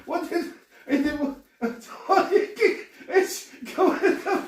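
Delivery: high, squeaky words through laughter; helpless laughter. Real, not acted. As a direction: splutter out words while cracking up. Water spraying everywhere mid-laugh.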